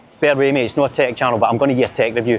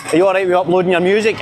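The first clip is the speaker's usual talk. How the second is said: retold eager question; 6 semitones higher, faster than usual. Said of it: "And I says to them".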